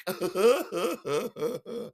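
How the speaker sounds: high-pitched ha-ha-ha; high imitated drunken laughter; drunken giggle. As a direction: laugh helplessly after the blow.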